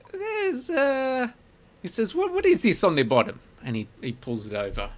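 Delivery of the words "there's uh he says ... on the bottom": much higher, louder, inquisitive merchant; curious shopkeeper. Acted. Shopkeeper inspecting the party's pots, in a curious, high voice. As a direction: peer at the pot suspiciously.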